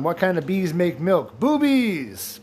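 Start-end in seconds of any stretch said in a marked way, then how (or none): 1.4-2.4 higher, gleeful punchline. Acted. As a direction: pop the answer out triumphantly. Riddle punchline shouted.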